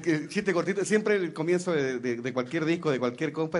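normal talking voice

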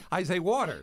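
"water" with a broad "aw" and a flapped "d", like wawder; Jersey water. Shown as a demonstration. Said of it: "W A D E R".

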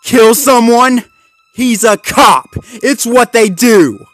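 very loud, high outburst; yelled, high-pitched argument; furious shouting. Acted. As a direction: shout it as bitter accusation. Zuma yelling at Marshall.

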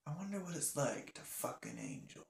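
soft, slightly wistful wondering aloud; hushed musing. Acted. Bart turning to his friend in the car, hushed musing. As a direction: wonder it quietly, almost to yourself. Bart muses before the crash.

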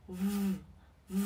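voiced V held as a long buzz; V rung cổ. Shown as a demonstration. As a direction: hum the V sound with the throat vibrating. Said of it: "rung cổ này". (âm V rung dây thanh, kéo dài)

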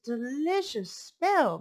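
slow, savoring character voice; lightly voiced hot dog vendor. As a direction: linger over delicious.

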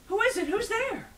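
high, loud, alarmed questions; jolted awake and frightened; startled fright. Acted. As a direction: call out in sudden panic. Startled by a horrible voice.

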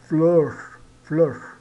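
"fleur" with a rounded French eu vowel; French vowel demo. Shown as a demonstration. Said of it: "como o E U francês". ("fleur" com o eu francês arredondado)

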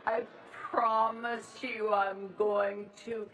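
ordinary talk, nothing marked

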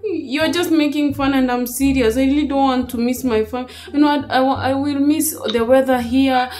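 usual speaking voice

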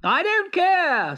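high, petulant royal voice, demanding; a vain emperor whining for clothes; spoiled emperor. Acted. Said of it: "said the Emperor".

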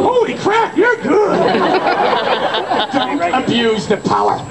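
ordinary talk, nothing marked

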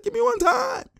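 very high falsetto begging; pleading player. Retold or imitated. Streamer voices the player wanting a pass, falsetto begging. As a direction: beg in a tiny squeal. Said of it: "He's like".

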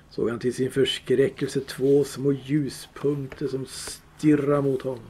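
ordinary delivery

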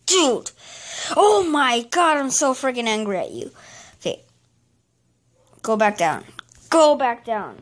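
usual speaking voice

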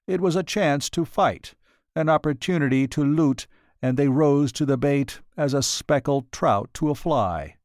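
plain reading voice

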